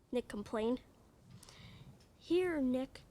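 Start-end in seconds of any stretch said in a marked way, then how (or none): none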